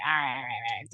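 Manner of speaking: low, soft, resigned surrender; giving in. Retold or imitated. Wife conceding husband was right, low resigned. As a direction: concede with a sigh in the voice. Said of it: "And I was like".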